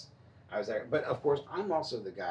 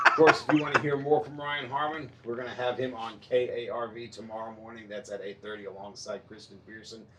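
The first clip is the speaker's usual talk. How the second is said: radio host read; 4 dB louder than usual. Acted.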